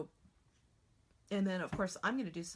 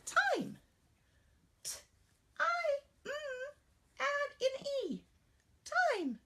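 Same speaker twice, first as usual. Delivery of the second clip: put-on much higher child sounding out letters; teacher voicing her student, much higher; little student voice; 4 dB louder, slower than usual. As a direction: sound out like a young pupil. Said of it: "And the student says".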